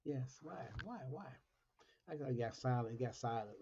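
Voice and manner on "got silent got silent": normal talking voice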